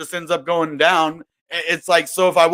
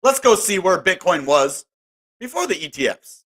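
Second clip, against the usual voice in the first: ordinary talk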